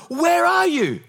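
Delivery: high, loud, hammed-up cry; desperate call. Believer searches for God in a storm, desperate call. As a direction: call out urgently for someone missing.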